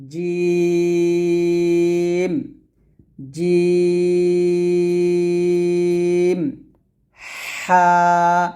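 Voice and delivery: each letter chanted with pauses, জিম long; recited letter line. Shown as a demonstration. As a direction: recite the row slowly for students. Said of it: "আবার বলেন আমার সাথে".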